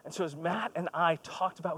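ordinary talk, nothing marked

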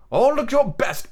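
Peter's high, slow warning. A narrator's reading. Peter warning the boys before knocking, slow warning. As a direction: warn them to look their best. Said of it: "Peter warned them".

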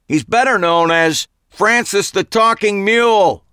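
high, loud call back across the lot; yelled back. A narrator's reading. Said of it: "yelled back at me".

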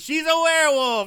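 high, loud dramatic exclamation; shouted reveal. Real, not acted. Film fan revealing what happens to the pursuing punk, shouting. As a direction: yell out the big twist.

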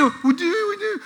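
high, soft mock whine; whining kids. Sons answering dad's scolding, mock whine. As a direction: imitate children whining at dad. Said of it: "the whole time they whine".

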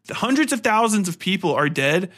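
normal talking voice

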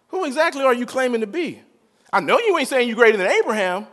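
high-pitched, indignant retelling of a challenge; worked-up disbelief, pitch lifted throughout; incredulous challenge. Retold or imitated. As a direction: act out the crowd demanding an explanation.

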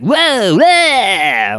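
high-pitched cartoon yelps, stretched out and falling; Sonic the Hedgehog. Host showing how the cartoon Sonic sounded, high cartoon impression. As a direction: imitate an over-excited cartoon hero. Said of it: "like that".

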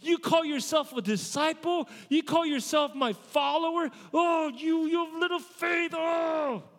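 mock furious preacher scolding a disciple; raging Jesus. Shown as a demonstration. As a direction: rage at Peter full force. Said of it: "He's not full on raging".

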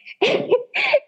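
short high real laugh, amused and a little embarrassed; tickled laugh. Real, not acted. Guest laughs at listeners praising her voice, a high tickled giggle. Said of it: "And I got tickled".

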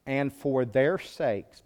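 "And for their sakes" read very slowly, word by word. Shown as a demonstration. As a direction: linger on each word of the verse. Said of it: "this verse jumped off the pages".

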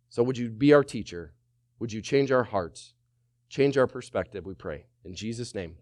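soft, hushed pastor's prayer. Acted. Pastor praying over his church, quiet and humble. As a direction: pray humbly for help, softly.

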